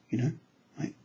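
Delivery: normal talking voice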